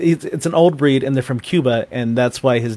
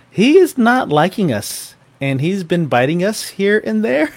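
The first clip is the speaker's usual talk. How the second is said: groomer's awkward voicemail; 7 semitones higher than usual. Retold or imitated. Groomer warns owner about biting dog, delicate high voice. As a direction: break bad news delicately, pitched up.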